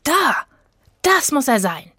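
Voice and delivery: high, loud excited shout; thrilled child spotting the circus tent; excited call. A narrator's reading. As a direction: point and cry out in delight. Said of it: "rief Rufus".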